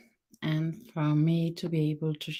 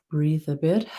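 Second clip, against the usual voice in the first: lightly calm, steady meditation guidance; walk listeners through their body, gently and evenly; guided meditation. Acted. Guiding webinar listeners through body awareness, a light meditation voice.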